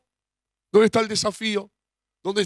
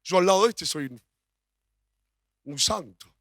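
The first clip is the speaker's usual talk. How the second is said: self-righteous quote; 3 dB louder than usual. Retold or imitated. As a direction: play the smug one pointing at someone worse. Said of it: "una persona que también dice". (tono farisaico fingido, subiendo agudo)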